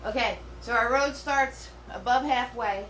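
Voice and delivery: ordinary talk, nothing marked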